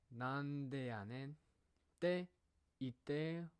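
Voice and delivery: slow Kansai. Shown as a demonstration. Slow Kansai phrase read.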